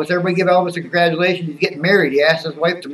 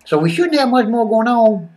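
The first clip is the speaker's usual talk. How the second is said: put-on character voice, pitched up and swinging; Skip. Skip chatting to viewers about wrestling, put-on excitable character voice. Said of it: "So, so it's Skip now".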